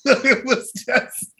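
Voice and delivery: sports fan laughs, remembering hitting herself; laughing. Real, not acted. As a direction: laugh at her own reaction.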